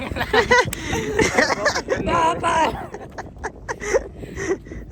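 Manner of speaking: a long, high-pitched laugh that falls away. Real, not acted. Laughing at the hour wasted on one reel. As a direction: laugh it off after the long shoot.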